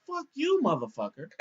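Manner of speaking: high-pitched "Fuck you", then back down; mock old lady cursing.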